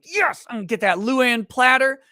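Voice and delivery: put-on a kid bouncing with joy over his dinner plan; child at the buffet line, getting giddier with every dish; excited kid. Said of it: "You're like".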